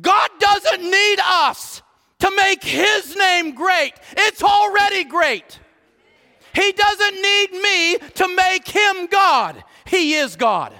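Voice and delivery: high, slow, shouted proclamation; impassioned loud pulpit shout; preaching at full force. Real, not acted. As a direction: drive each word home to the congregation.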